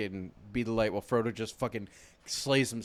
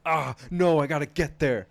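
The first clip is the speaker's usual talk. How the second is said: louder, straining sidekick cry; mock desperate Sam; 3 semitones higher, 7 dB louder than usual. Friend retelling the fight, voicing Sam straining to reach Frodo. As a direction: push to reach him, hammed up. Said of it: "Sam's just like".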